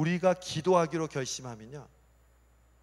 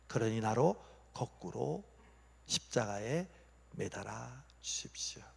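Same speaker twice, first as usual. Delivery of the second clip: solemn plea; 6 semitones lower, 9 dB quieter than usual. Acted. Peter's dying request.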